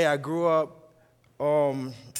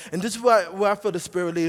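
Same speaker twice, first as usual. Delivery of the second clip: ordinary talk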